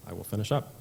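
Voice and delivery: ordinary talk, nothing marked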